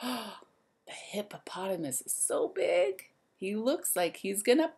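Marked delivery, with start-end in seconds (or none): 0.0-3.1 hushed, wide-eyed whisper; amazed whisper. Acted. Teacher whispering to the kids about the huge hippo. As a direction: marvel at its size under your breath.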